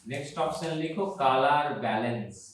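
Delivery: unhurried repeat for note-taking; slow dictation. Shown as a demonstration. Teacher repeats the heading for students writing it down, slow dictation. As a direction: dictate slowly, word by word.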